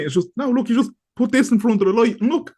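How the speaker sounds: high, wide-eyed excitement; like showing off a new trick; excited discovery. Retold or imitated. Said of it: "it's just that scene".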